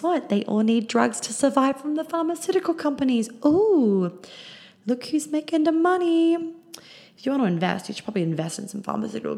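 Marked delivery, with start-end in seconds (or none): 3.4-6.3 high mocking sarcastic voice. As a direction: sneer it, pitched way up. Sarcastic jab at drug companies.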